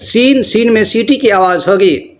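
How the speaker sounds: normal talking voice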